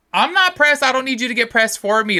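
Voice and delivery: high-pitched, emphatic protest; mock indignant retort. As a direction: push back at an overprotective defender, voice jumping up. Acted retort to defenders.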